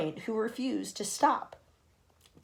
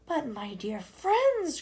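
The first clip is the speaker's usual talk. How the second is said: slow, higher, cheery voice; cheerful old grasshopper; 7 semitones higher, 3 dB louder, much slower than usual. Acted. Old grasshopper cheering the bruised creatures. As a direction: lift everyone's spirits. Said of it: "cried the old green grasshopper, trying to be cheerful".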